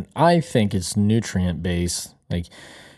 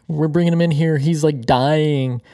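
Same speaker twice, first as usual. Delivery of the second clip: panicked parent; 6 semitones higher, 3 dB louder than usual. Retold or imitated. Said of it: "we look like the parents is, like, over concerned".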